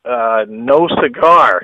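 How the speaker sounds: very slow, drawn-out verdict; slow comic punchline; deadpan retold refusal. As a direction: let it down slowly, word by word.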